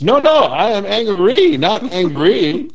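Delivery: hammed-up Chinese accent cracking into laughter; mock Ang Lee accent. Ang Lee correcting the waiter, mock Chinese accent, laughing. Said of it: "impression of Ang Lee".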